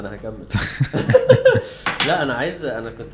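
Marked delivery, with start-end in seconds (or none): 0.5-2.0 breathy laugh. Real, not acted. Laughs at friend.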